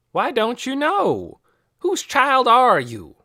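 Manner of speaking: put-on old woman scolding a stranger's child, loudly; angry demand. A narrator's reading. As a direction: confront the strange girl, slow and loud. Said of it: "glared at her".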